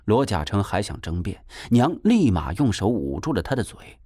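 ordinary delivery, nothing marked

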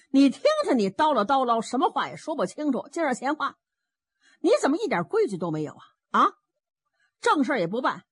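mildly exasperated telling-off; scolding. Acted. Fourth Master tells Zhao Bi off for rambling, mildly scolding.

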